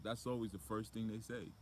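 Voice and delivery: ordinary talk, nothing marked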